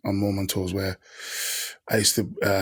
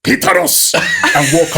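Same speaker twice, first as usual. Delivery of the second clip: "Clitoris" in a much higher mock African accent; 5 dB louder, slower than usual. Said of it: "in an African accent".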